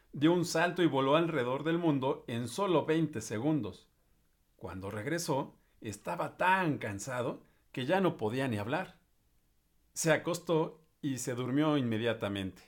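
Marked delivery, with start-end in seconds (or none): none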